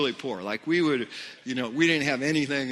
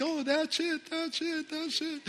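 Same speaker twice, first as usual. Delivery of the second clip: mock high, hushed cheering-on; much higher imitated voice, repeated encouragement; excited prayer helpers; 13 semitones higher, 4 dB quieter than usual. Prayer team urging on a woman speaking in tongues, high mock encouragement. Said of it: "and they're like".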